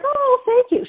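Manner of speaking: very high, gushing thanks; mock flattered friend. Friend buying a compliment on her French, mock gushing.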